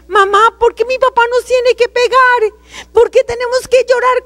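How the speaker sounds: high-pitched pleading, reenacted; child's plea. Daughter asking mamá about her father, pleading.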